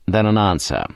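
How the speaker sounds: plain reading voice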